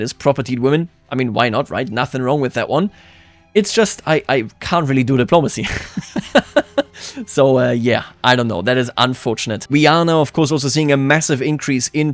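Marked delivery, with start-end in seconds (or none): 5.7-7.2 high giggling laugh; real high-pitched chuckle. Real, not acted. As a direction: laugh helplessly at your own predicament. Laughs at failed diplomacy.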